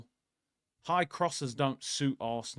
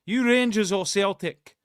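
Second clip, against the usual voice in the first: loud, higher mock Glaswegian; amateur Glasgow accent, pitched up; 5 semitones higher, 6 dB louder than usual. Shown as a demonstration. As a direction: try on a Scottish accent, loudly. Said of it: "that's a pretty good Glaswegian accent".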